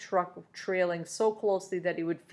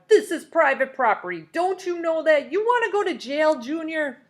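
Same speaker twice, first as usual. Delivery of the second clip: angry foreman; 9 semitones higher, 6 dB louder than usual. A narrator's reading. Foreman threatening trespassing kid.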